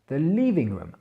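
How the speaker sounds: The living room with LIV- stressed, rest reduced; accent sur LIV- de living room. Shown as a demonstration. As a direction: lean on the first syllable of living. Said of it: "l'accentuation est sur la première syllabe".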